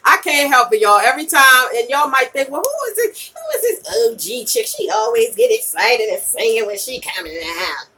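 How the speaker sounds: ordinary delivery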